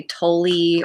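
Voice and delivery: Tolle said as two syllables, ending in -ee; common mispronunciation. Shown as a demonstration. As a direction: say Tolle like "TOE-lee". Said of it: "a lot of people say".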